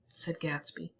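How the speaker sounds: ordinary talk, nothing marked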